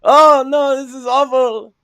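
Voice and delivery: high, loud whiny mock surrender; a Frenchman begging to surrender, high and loud mock pleading. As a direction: ham up a begging coward giving in. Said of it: "They'll say".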